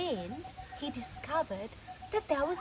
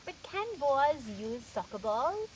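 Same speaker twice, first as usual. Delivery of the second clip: ordinary delivery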